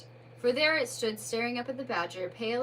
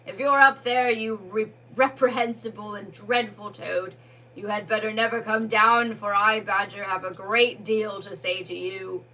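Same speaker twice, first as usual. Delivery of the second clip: booming, much louder threat; loud cry skyward; 8 dB louder than usual. Acted. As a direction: Badger shouts up at Toad in the sky. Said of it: "cried up towards the snowy night sky so loudly".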